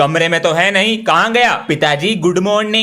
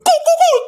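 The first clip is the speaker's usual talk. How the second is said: train whistle noise; 3 dB louder than usual. Pretend. Child imitating a train whistle.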